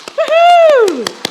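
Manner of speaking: high, loud whoop; excited cheer. Real, not acted. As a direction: whoop to rouse the crowd. Cheering to start applause.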